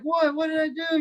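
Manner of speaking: high-pitched bewildered protest; retold panic. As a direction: imitate a rattled bandmate pleading innocence. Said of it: "he's just in pure panic mode".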